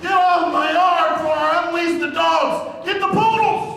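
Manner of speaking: put-on high, slow, shouted old man's threat; angry old coach yell. Old coach yelling at rioting students to get off. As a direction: bellow it like a furious old coach chasing kids.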